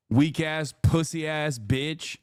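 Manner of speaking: ordinary talk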